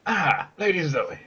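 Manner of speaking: slow greeting in a put-on voice; changed character voice. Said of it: "I don't know why my voice changed".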